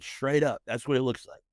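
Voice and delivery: ordinary delivery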